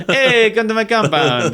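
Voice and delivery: loud, high hammed-up dictator; Mussolini. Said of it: "What a great Mussolini impression".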